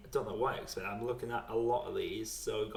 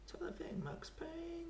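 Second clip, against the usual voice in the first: muttered whisper; 12 dB quieter, slower than usual. Real, not acted. Muttering to himself while thinking.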